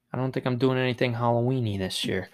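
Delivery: ordinary delivery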